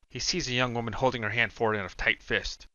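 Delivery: plain reading voice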